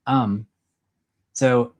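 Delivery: ordinary talk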